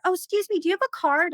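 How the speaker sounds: high-pitched polite stranger's request; inquiring stranger. Retold or imitated. Stranger stops a supposed dog walker for a card, politely inquiring. Voices a stranger approaching her.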